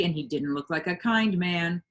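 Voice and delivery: usual speaking voice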